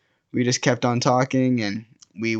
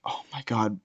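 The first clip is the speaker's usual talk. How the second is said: soft, defeated aside; quiet dismay; 9 dB quieter than usual. Retold or imitated. Guy watches his friend dragged away again, quiet dismay.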